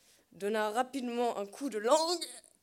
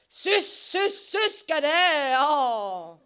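appel sifflé; 3 semitones higher, 7 dB louder, much slower than usual. Acted. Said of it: "de sa voix monotone, François siffla".

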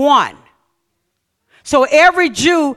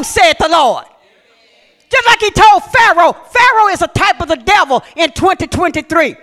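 preaching at full force; 4 semitones higher than usual. Acted. Pastor preaching to his congregation about forgiveness, full force. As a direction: drive it home to the congregation.

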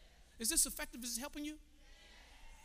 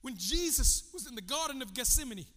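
usual speaking voice

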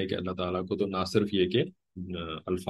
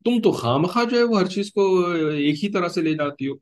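high-pitched acted reproach; raised, accusing voice of a defensive son; son defending his mother; 8 semitones higher, 4 dB louder than usual. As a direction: play a son siding with mum against his wife. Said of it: "वो कहेगा".

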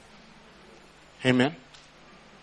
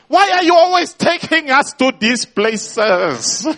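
high, pleading complaint, a bit louder; whiny Holy Spirit; 10 semitones higher, 3 dB louder than usual. Acted. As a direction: play the Spirit tugging at your sleeve, exasperated.

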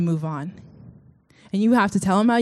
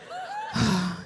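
soft, breath-heavy sigh of dismay; mock dismayed sigh; 7 dB quieter than usual. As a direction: sigh as if crushed by failure. Mimicking dismay over a broken streak.

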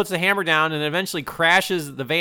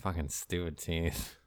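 low, very soft muttering; weary grumble; 11 semitones lower, 16 dB quieter than usual. Acted. Survivor fed up with the toy teeth, weary mutter. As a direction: grumble it under your breath.

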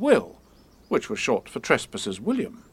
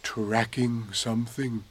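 slow, hushed mysterious bear; mysterious Pooh; mysteriously; 6 dB quieter, slower than usual. A narrator's reading. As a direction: keep it secret and slow. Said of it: "said Winnie the Pooh very mysteriously".

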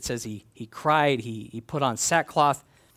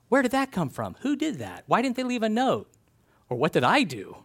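higher, exasperated questions; fuming over a scratch on your car; mock indignation; 6 semitones higher than usual.

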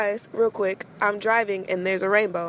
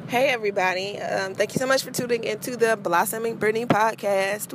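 ordinary talk

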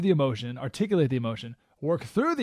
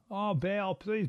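mock plea in a soft, higher voice; pleading false prophets; 6 semitones higher, 5 dB quieter, much slower than usual. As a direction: mockingly beg a god to answer. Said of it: "They start calling to Baal".

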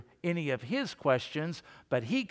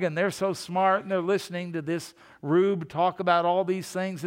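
usual speaking voice

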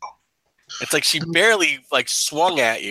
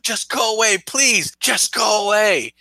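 desperate pleading; 7 semitones higher than usual. Acted. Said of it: "And she's like".

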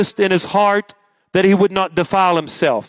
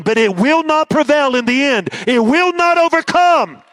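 rousing preaching; 8 semitones higher than usual. Acted. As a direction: build to a triumphant shout. Preaching builds to shout.

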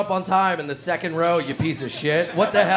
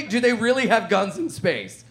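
ordinary delivery

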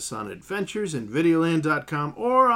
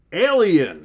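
loud punchy title announce; dramatic title reveal; 2 semitones higher, 8 dB louder than usual. Acted. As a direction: hit the title hard.

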